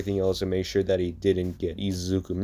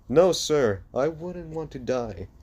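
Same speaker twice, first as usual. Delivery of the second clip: high nervous reply; 7 semitones higher than usual. Acted. Jean answering the captain.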